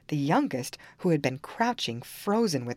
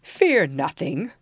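loud, slow reassuring ogre; gentle giant voice; 6 dB louder, slower than usual. Acted. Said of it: "said the ogre again".

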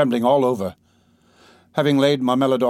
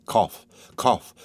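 hoarse spoken coughs; coughing fit. Acted. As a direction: cough painfully mid-sentence. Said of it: "she articulated with effort".

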